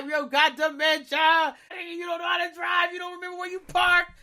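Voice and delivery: roast him like a rowdy buddy; mocking friend. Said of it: "Genkai is like".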